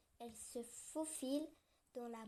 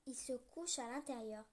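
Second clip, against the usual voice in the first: ordinary delivery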